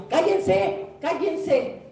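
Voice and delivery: stern rebuke. Acted. Jesus silencing the demons.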